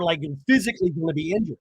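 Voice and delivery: ordinary delivery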